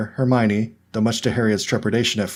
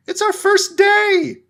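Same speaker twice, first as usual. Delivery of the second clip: much higher, louder shout, slowed; yelled back; 5 dB louder, much slower than usual. A narrator's reading. Girl yelling back at the wailing ghost. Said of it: "Ronnie yelled back".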